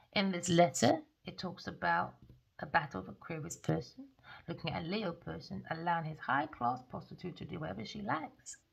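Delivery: normal talking voice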